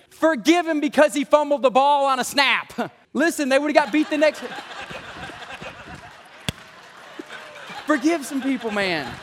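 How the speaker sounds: loud, high-pitched preacher's plea; fired-up pulpit exclamation; impassioned preaching. Real, not acted.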